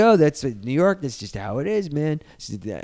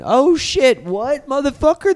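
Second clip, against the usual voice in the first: high, loud mock disbelief; stunned, pitch leaping up; shocked outburst; 13 semitones higher, 5 dB louder, slower than usual. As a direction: blurt it out like a jaw-dropping reveal. Reenacts shock at plot twist.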